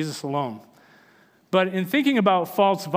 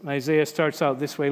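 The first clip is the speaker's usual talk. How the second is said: ordinary delivery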